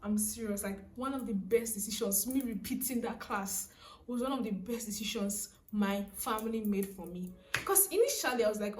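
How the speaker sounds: normal talking voice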